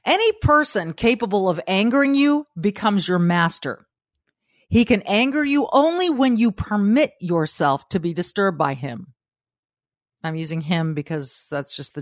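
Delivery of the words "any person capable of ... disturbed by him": read closely. Acted. Host rereading Epictetus for viewers, emphatic. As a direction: lay the quote out word by word. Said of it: "Listen closely".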